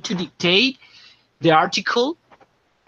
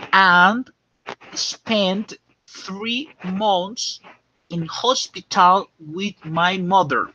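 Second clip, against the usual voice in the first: slow dictation; slower than usual. Shown as a demonstration. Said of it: "Pay attention".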